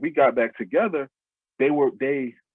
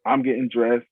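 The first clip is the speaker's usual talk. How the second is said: ordinary delivery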